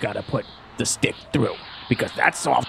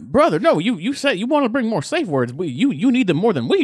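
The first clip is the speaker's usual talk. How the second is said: high-pitched protest; 8 semitones higher than usual. Acted.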